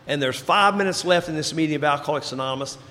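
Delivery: ordinary talk, nothing marked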